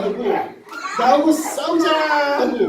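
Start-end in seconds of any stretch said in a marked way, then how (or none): 1.0-2.4 slow, high, drawn-out challenge cry; giant's challenge. Shown as a demonstration. Teacher shows the Goliath actor how to shout, slow challenge. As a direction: stretch it out like a taunting giant. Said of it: "하고 소리를 질러봐".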